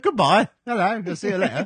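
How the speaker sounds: high, loud mock British parrot. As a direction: squeal a British bird's farewell. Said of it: "He's like".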